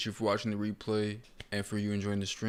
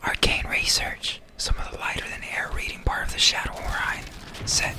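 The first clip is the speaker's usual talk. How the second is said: loud stage whisper, no voice; 4 dB louder than usual. Real, not acted. Streamer reading item descriptions to viewers, loudly whispering. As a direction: whisper forcefully right into the mic. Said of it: "I'm just whispering".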